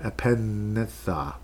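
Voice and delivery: "Epinesa" said slowly, syllable by syllable, with no S sound; careful correction. Shown as a demonstration. Host correcting his own misreading of the kicker's name, slow and careful. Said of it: "no, it's not an S".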